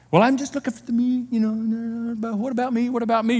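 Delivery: mock a parent asking what about me; a touch of a whining parent; whiny parent. Voicing a self-focused parent.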